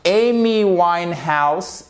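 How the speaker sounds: Amy Winehouse lentement, le A dit « ei »; prononciation anglaise. Shown as a demonstration. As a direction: say Amy Winehouse slowly, long EY on Amy. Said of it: "vous avez l'accent tonique ici". (prononciation anglaise bien articulée)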